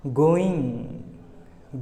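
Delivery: गोइंग stretched out with a long nasal ng; very slow ng demo. Shown as a demonstration. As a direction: hold the ng of गोइंग. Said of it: "आखिरी में गा का sound नहीं आएगा".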